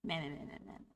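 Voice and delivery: deep put-on voice changer voice. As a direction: sound like a voice changer. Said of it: "using a voice changer".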